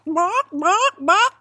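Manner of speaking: three loud, high rising clucks; high-pitched "bawk, bawk, bawk"; chicken squawks. Acted. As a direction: cluck three times like an insistent hen. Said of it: "points back to the stacks again, says".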